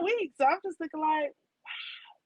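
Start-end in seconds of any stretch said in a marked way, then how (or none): 1.6-2.2 whispered wow. Retold or imitated. Stunned by neighbor's remark.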